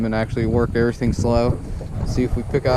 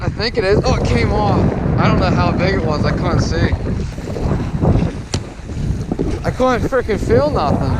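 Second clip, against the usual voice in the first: excited; 5 semitones higher than usual. Real, not acted. Fish on the line, then lost.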